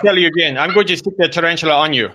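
high-pitched comic threat; mock exasperated father. Father threatening Junior with a tarantula, mock exasperated. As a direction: scold him in a put-on dad voice.

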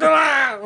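high, laughing startled yelp. Retold or imitated. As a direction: jump as if grabbed. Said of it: "and I'm like".

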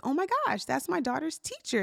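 high excited oh my gosh; bright retold excitement; excited gasp. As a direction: squeal it out like a happy surprise. Excited retold reaction at store.

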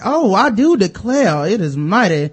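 drawled Southern belle voice. Retold or imitated. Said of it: "I started talking like a Southern belle".

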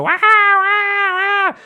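very high yapping bark; dog bark. Retold or imitated. Noisy kid barking in the restaurant, high yapping. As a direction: yap like a small dog. Kids making noise nearby.

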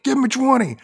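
loud, high barked command; hammed-up military shout; drill sergeant order. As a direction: bark it like a sergeant demanding push-ups. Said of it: "drill sergeant then orders them".

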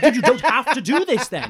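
high laughing retort; laughing disbelief. Retold or imitated. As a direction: laugh through it, incredulous. Laughing while retelling own reply.

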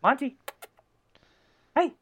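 high call to a dog. Real, not acted. Calling the dog.

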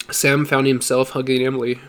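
ordinary delivery, nothing marked

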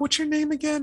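sudden high-pitched question of recognition; surprised realization. Retold or imitated. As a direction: ask it as if something just clicked. Realizing he knows the man.

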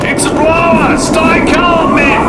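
loud, high commanding shout; shouting over wind. Acted. Mackenzie rallying the men in the storm, loud shout. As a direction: bellow orders over the gale.

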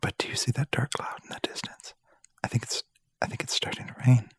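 airy ASMR whisper, quick at the end. Acted. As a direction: murmur it close and intimate. Whispered ASMR podcast bit.

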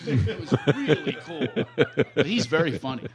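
usual speaking voice

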